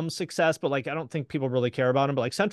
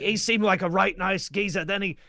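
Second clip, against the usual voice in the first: higher, hammed-up Cockney voice telling a scary tale; Danny Dyer impression; 6 semitones higher, 3 dB louder than usual. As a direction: spoof Danny Dyer's mock-scared Cockney narration. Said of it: "every single Danny Dyer episode".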